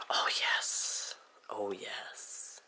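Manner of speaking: soft, whispery delight; whispered, lingering approval; hushed relish. Real, not acted. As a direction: breathe it out, savouring the sight. Savouring the look of the pieces.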